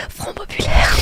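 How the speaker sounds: forceful stage-whispered slogan; mock protest cry. Streamer joking about French strikes, mock rallying whisper. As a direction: call it out like a protester, still whispering.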